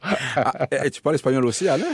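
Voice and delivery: light up at the news he speaks Spanish; eager surprised question. Real, not acted. Host learns guest speaks Spanish.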